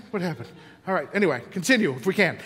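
ordinary talk, nothing marked

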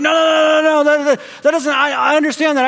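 hammed-up protest, cutting in; mock protest shout. Man protests in retold conversation.

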